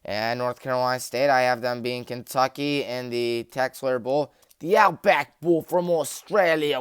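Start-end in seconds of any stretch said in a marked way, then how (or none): 4.6-6.8 a deliberately bad, hammed-up Australian accent; terrible Australian accent. Announcing a bowl pick in a bad Australian accent. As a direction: put on a cheesy Australian accent for the bowl name. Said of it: "with a terrible Australian accent".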